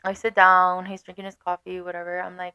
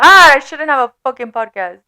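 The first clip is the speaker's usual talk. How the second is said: loud, exasperated groan at herself; exasperated outburst; 4 semitones higher, 10 dB louder than usual. Real, not acted. Vlogger scolding herself for rambling, loud and exasperated. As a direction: blurt it out, fed up with yourself. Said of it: "Oh my God".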